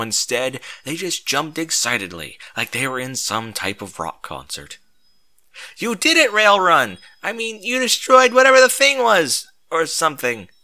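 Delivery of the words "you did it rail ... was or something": much higher, loud squeaky cheer; excited random pony. A narrator's reading. Random pony cheers the hero, high and excited. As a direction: gush like a starstruck little pony. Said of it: "said some random pony".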